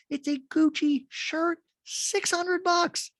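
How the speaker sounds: incredulous high-pitched shock at the price; voice gets all hot. Real, not acted. Podcaster squeaking at a six hundred dollar shirt, shocked and high. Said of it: "My voice gets all hot".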